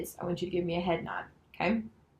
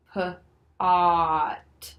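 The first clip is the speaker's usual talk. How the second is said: slow sounding-out; 7 dB louder, much slower than usual. Shown as a demonstration. Teacher showing Jim how to blend, slow 'P, ah, T'. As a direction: stretch 'P, ah, T' into one slow blend, loud long vowel. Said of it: "say 'em together slowly".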